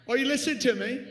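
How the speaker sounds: loud, high call to the room; shouted question to the congregation. Real, not acted. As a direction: demand their attention. Preacher calls out to congregation.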